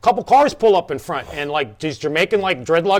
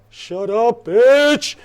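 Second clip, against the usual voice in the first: higher put-on voice, louder at the end; retold defiant inner retort; 5 semitones higher, 3 dB louder, much slower than usual. Band member's thought beside Tony, mock defiant retort. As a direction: imitate a cocky silent comeback. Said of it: "going to myself".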